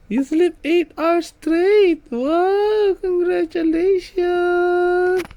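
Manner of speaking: slow, very high cooing congratulations. Real, not acted. Parent praises baby.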